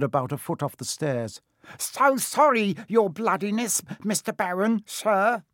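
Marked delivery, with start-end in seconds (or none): 1.8-5.4 very high, oily grovelling; greasy. A narrator's reading. Peeves apologises to the Baron, high greasy grovelling. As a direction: fawn in a high servile squeak. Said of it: "he said greasily".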